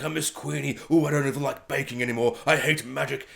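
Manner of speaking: soft, low mopey quote; mock sad. Reviewer mimicking Jacob missing Queenie, mock sad. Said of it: "Jacob's all sad".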